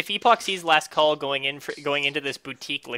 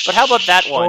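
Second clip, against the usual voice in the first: sly suggestion; 5 semitones higher, 8 dB louder, faster than usual. Acted. Character points to pricey bottle.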